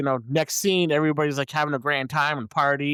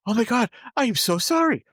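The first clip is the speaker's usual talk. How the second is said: high, hammed-up apology; overdone, high "so sorry" plea; sudden mock repentance; 8 semitones higher than usual. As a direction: gush remorse in a pitched-up imitation. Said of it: "all the screaming he was doing, and then all of a sudden".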